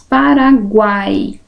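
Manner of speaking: Paraguai drawn out slowly, each syllable clear; teacher drawing out an example word. Shown as a demonstration. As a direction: say Paraguai slowly so the gliding vowels are heard.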